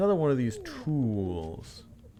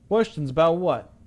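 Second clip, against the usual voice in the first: character voice; 8 semitones higher, 8 dB louder than usual. Acted. Sobbing man voiced.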